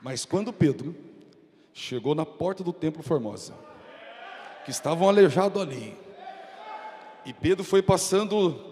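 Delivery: ordinary talk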